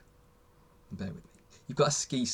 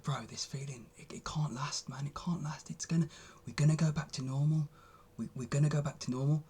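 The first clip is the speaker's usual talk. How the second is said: soft, half-whispered worried voice, a touch higher; scared inner voice; 3 semitones higher, 3 dB quieter than usual. Retold or imitated. Talking to himself as his scared inner voice, hushed and worried. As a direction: whisper the fear that the good feeling can't last. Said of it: "quite a scared voice".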